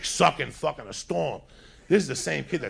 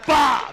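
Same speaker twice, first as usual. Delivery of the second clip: loud, high imitated impact burst; punch noise; 12 semitones higher, 10 dB louder than usual. Storyteller acting out the punch landing, shouted impact noise. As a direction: blurt the punch as a sudden shout.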